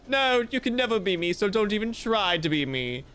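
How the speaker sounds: normal talking voice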